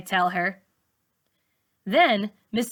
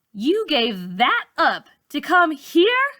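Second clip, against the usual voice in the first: amazed; 7 semitones higher, 5 dB louder, slower than usual. A narrator's reading. Malika amazed at teacher.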